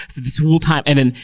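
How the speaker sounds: ordinary talk, nothing marked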